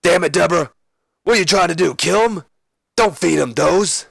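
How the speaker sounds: exasperated high rasping outburst; Stone Cold Steve Austin. Acted. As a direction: scold loudly in a put-on wrestler voice. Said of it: "And Austin goes".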